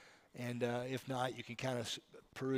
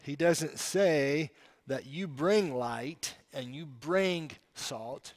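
usual speaking voice

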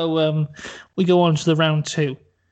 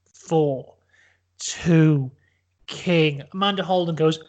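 ordinary talk, nothing marked

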